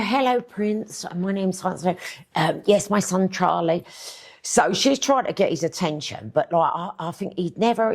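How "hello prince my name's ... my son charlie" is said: imitating a put-on posh accent, pitched higher; mock-posh introduction with a drawn-out hello. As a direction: put on a posh voice to impress royalty. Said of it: "she'll put on the posh voice".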